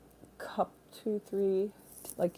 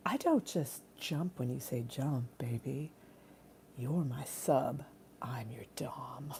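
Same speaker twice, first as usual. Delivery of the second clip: low, soft, slow teasing purr; sultry dominatrix; 4 semitones lower, 12 dB quieter, slower than usual. Pretend. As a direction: tease him as a mock dominatrix. Playful dominatrix act.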